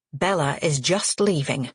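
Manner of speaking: usual speaking voice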